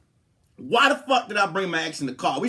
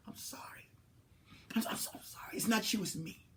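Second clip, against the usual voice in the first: softer whisper, pleading sorry; whispered letdown; 10 dB quieter than usual. Retold or imitated. Turning down the ex in the bathroom, hushed whispered apology. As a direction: breathe it out, apologetic.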